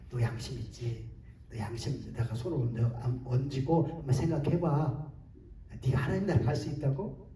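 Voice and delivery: lightly voiced devil, low and soft; hushed, low, accusing challenge. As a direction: quietly needle someone's conscience. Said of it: "마귀가 이렇게 공격하면".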